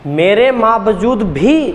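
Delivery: slow, with added stress landing on भी; मेरे बावजूद भी slowly, भी punched high; doubled emphasis. Shown as a demonstration. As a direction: lean hard on भी after बावजूद, pitch up. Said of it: "फिर भी मैं भी जोड़ दूँ".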